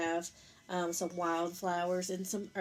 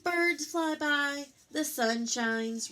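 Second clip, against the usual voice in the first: storybook wonder; 6 semitones higher, 6 dB louder, slower than usual. A narrator's reading. Spring arrives in story.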